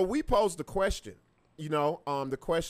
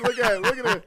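laughing; 6 semitones higher, 7 dB louder than usual. Real, not acted. Laughing at their teeth.

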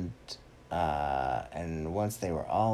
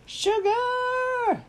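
high, loud drawn-out sheep cry; excited sheep; 6 dB louder than usual. Acted. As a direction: squeal it like a sugar-mad sheep. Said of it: "And the sheep were like".

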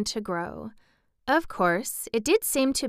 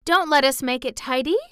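put-on mildly anxious girl, higher and louder; 6 semitones higher, 5 dB louder than usual. A narrator's reading. Mary pleading against tidying, mildly anxious. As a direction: urge him with a touch of worry. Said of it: "said Mary anxiously".

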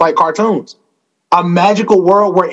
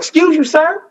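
mock indignant; 9 semitones higher than usual. Indignant polite retort.

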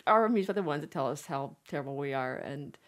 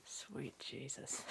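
slow breathy whisper; 6 semitones lower, 20 dB quieter, much slower than usual. Real, not acted. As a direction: breathe it out in awe. Mock-shocked aside.

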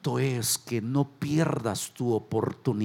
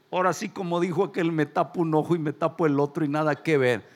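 ordinary delivery

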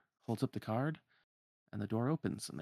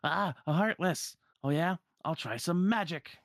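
high, louder combative cry; Donald; 8 semitones higher, 4 dB louder than usual. Acted. Donald faces a Heartless and readies magic, high and excited. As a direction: draw it out, alarmed then cocky. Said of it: "And then [name] goes".

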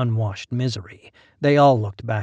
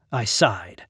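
ordinary delivery, nothing marked

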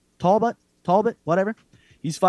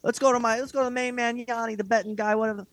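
much higher mock announcer voice; high sarcastic imitation of a TV handoff; mocking the broadcast handoff; 6 semitones higher than usual. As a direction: send it over to the betting guy, high and sarcastic. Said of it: "Yanni's their guy".

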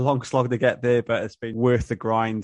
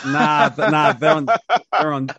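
laughing through the words, louder; chuckling out the joke about dial-up; 3 semitones higher, 5 dB louder than usual. Real, not acted. Joking about slow internet.

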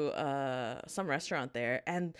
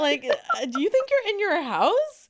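high, loud indignant vendor voice; mock scolding auntie; 11 semitones higher, 7 dB louder than usual. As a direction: scold like an offended Vietnamese mother. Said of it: "She's like".

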